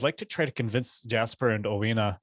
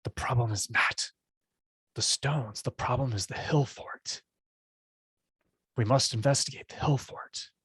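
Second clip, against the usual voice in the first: urgent stage whisper, higher-pitched; hissing whisper; 3 semitones higher than usual. Acted. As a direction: whisper it as a hiss, close to the listener. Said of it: "in a hissing whisper".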